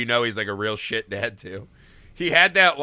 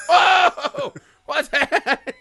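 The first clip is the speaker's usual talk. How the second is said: mock surprise; 11 semitones higher, 6 dB louder, much slower than usual. Mimicking Sarsgaard's reaction to plate.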